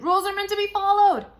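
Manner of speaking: high, fast frantic shout. Acted. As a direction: yell it in a panic to stop her. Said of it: "Ian shouted".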